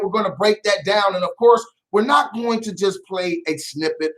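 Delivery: normal talking voice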